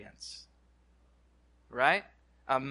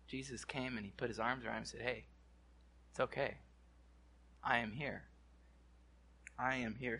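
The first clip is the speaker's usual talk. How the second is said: much softer, comforting Jesus; gentle comfort; 5 semitones lower, 14 dB quieter than usual. Acted. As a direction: soothe scared friends softly. Jesus comforting frightened disciples.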